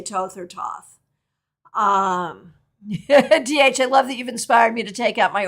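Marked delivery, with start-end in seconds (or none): 0.0-1.0 "Toth or Thoth" as TOHTH or TAWTH; tarot-world pronunciation. Shown as a demonstration. Teacher giving tarot world's usage, "Toth or Thoth" as TOHTH or TAWTH. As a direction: say "Toth or Thoth" with hard T, first long O, then AW. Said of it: "but in the tarot world".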